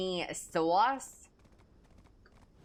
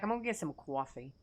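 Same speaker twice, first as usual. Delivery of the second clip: New Jersey accent; 5 semitones lower, 4 dB quieter, faster than usual. Shown as a demonstration.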